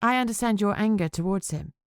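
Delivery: plain reading voice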